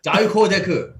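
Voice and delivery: "Daikodeku" as dye-koh-deh-koo; even syllables on "Daikodeku", DAI first; Japanese pronunciation. Shown as a demonstration. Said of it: "pronounced in Japan".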